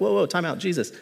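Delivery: mock-shocked heavenly onlookers; astonished angels. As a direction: play the angels, amazed and laughing. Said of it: "they're like".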